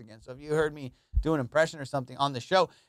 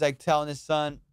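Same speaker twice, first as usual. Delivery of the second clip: ordinary delivery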